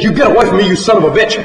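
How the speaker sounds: high, loud frantic yell; screaming. A narrator's reading. John warning off the sow with a pistol, screaming. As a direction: scream to drive it off. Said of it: "John screaming".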